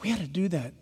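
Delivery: normal talking voice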